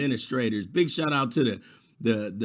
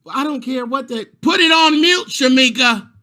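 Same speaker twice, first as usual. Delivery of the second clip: high, loud yell; parent yelling; 10 semitones higher, 9 dB louder than usual. Retold or imitated. Parent telling her child to mute, loud and high. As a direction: holler across the house, much higher.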